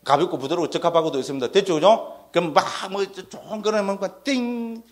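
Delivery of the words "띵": drawn-out ringing "띵"; imitated mystical chime. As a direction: let a sustained ding ring out like a mystical sound. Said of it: "신비한 소리". (신비하게 길게 울리는 띵 소리)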